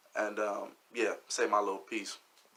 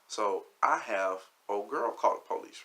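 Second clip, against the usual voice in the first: ordinary talk, nothing marked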